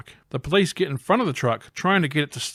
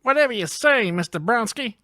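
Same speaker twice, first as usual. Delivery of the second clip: henchman voice; 9 semitones higher than usual. Acted. Voicing henchman.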